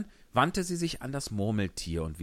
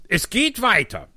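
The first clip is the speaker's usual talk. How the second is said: Hutmacher; 14 semitones higher, 12 dB louder than usual. Acted. Hutmacher erzählt, laut.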